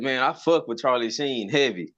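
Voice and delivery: ordinary talk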